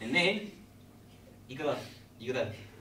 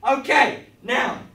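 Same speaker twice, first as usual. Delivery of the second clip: higher and louder, laughing, hammed-up; manic excitement; 10 semitones higher, 20 dB louder than usual. Real, not acted. Host to kids, manic laughing presentation. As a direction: sell it like a mad host.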